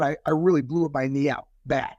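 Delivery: ordinary delivery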